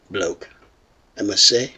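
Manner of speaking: ordinary talk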